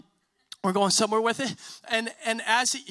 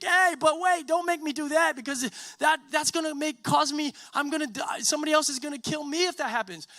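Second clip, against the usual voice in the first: high-pitched, urgent mock pleading; acted pleading; 6 semitones higher than usual. Cain bargaining with Yahweh for his life, acted pleading. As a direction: beg to be spared, voice pitched up. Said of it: "Cain even pleads".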